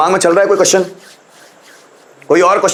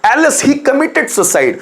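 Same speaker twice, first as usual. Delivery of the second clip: slow mournful cry, pitched higher; higher slow sorrowful alas; 7 semitones higher, much slower than usual. Shown as a demonstration. Teacher repeating grief example, higher slow mournful cry. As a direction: wail the alas slowly. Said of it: "LS word दुख को प्रदर्शित".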